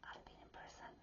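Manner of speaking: hushed confession; whisper. Real, not acted. Telling Sagittarians her past, a whispered confession. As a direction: whisper it like a secret.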